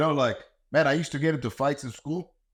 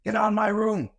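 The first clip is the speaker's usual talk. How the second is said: whiny teenager shrieking at a parent, imitated; mock teen scream; 10 semitones higher than usual. Mimicking a kid screaming at mom and dad, high bratty yell. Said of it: "they scream".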